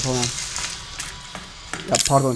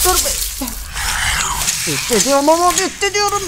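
exasperated high whine; faster than usual. Real, not acted. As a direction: whine in disbelief at the game. Frustrated high complaint. (yüksek sesle sitem)

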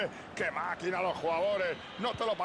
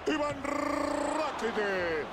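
slow, high stretched name call; drawn-out player call; 5 semitones higher, much slower than usual. Acted.

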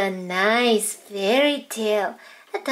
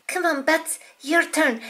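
ordinary talk

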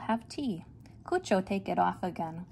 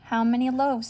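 cheerful baker; 4 semitones higher, 7 dB louder than usual. Acted. Baker asks loudly.

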